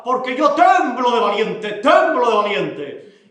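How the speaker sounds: lento y fuerte, hinchado de orgullo; fanfarrón presumido. Acted. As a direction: presume de valiente a voz alzada. Xico presume ante su mujer. (Xico fanfarrón, hablando lento y fuerte a Manuelona)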